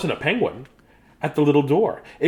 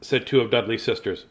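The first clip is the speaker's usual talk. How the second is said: ordinary delivery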